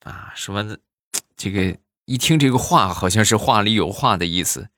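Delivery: ordinary talk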